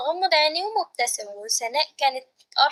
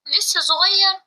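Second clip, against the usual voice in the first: higher, louder incredulous question; raised-pitch, mock-amazed comeback; astonished echo; 5 semitones higher, 6 dB louder, slower than usual. A narrator's reading. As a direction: throw "صغير" back in loud disbelief. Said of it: "سناء بعجب بق".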